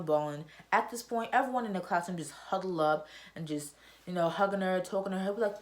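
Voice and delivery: ordinary delivery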